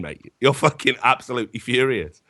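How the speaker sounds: ordinary talk, nothing marked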